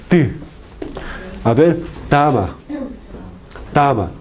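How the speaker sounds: "ti" and "tama" with a fully stopped t; teacher's model of "tama", t as a stop; oclusiva t. Shown as a demonstration. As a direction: tap out a clean plosive t in "tama". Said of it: "Una consonante oclusiva".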